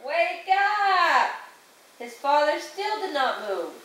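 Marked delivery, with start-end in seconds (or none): 0.1-1.4 high, drawn-out storybook call; mock loud yell. A narrator's reading. Jason trying to rouse his father, mock loud yell. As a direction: stretch both words in a raised call. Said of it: "yelled in the loudest possible voice".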